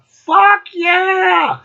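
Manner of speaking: high, shrill-free whoop of fuck yeah; mock fan cheer. Vlogger mocking a fan who loves the album, whooping.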